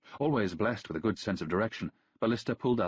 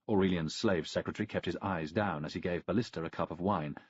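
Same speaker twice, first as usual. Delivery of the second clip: usual speaking voice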